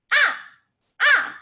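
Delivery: short, sharp falling "ah" from a high start; angry fourth tone. Shown as a demonstration. Teacher demonstrates fourth tone, short angry falling "ah". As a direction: yell out "ah" as if mad. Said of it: "Put the fourth tone".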